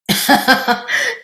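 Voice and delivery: a short, high, breathless burst of laughter; breathy laugh. Real, not acted. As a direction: laugh in disbelief at the surprise. Laughing at bungee surprise.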